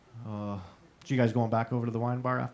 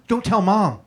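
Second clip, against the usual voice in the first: higher, louder panicked hush-up; petrified plea; 7 semitones higher, 6 dB louder, slower than usual. Retold or imitated. Brother begs kid not to tell Mom, panicked. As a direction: beg him not to tell.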